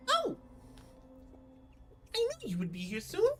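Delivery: a hint of a chirpy pixie. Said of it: "Wasn't prepared for the pixie voice".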